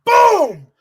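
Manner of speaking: high, loud mock boom; mock impact noise, high and loud. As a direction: smash the sound out like a hit. Mimics the run-in hit.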